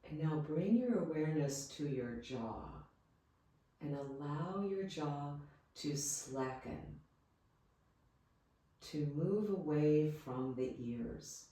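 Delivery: soft, calm Yoga Nidra guidance with long pauses; guided meditation. Real, not acted. Yoga teacher leading listeners into rest, guided meditation voice. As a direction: guide them gently, leaving space after each phrase.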